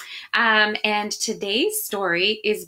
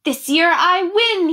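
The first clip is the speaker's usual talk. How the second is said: higher, louder and slow, savoring victory; confident boast; 7 semitones higher, 5 dB louder, slower than usual. Acted. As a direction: draw it out like a smug kid who knows he won. Child finds envelope, boasts.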